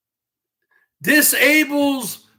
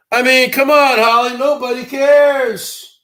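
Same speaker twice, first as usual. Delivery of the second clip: usual speaking voice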